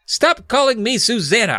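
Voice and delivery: high, mild shout; shouted protest. Acted. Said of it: "I shouted".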